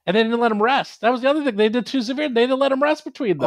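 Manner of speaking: getting heated about the missing rests; worked up. Real, not acted. Host to co-host recounting a party wipe, rising disbelief. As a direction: pitch climbing, voice rising in disbelief.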